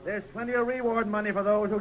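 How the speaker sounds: loud, high rallying call. Acted. Sheriff to the posse about the reward, loud call. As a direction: call the men to attention.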